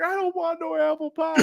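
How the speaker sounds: very high, hammed-up whine; spoiled brat. Kid refusing the apple pie, spoiled brat whine. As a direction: whine like a bratty child, pitch through the roof. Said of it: "I was acting like a spoiled brat".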